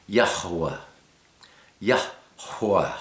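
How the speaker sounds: "Yahweh" with a strong breathed H in the center; emphasized middle H. Shown as a demonstration. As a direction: blow out the middle of "Yahweh": Yah-hwah. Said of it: "with a little more emphasis".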